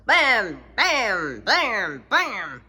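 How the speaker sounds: loud knocking bam bam; knocking sounds. Acted. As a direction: pound out each bam. Knocking on the door.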